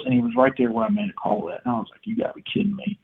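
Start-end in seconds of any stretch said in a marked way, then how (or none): 2.0-3.0 high, breathy disbelief; can't believe it, under your breath; hushed disbelief. Retold or imitated. Disbelief as bird gobbles close.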